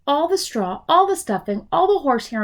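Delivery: ordinary delivery, nothing marked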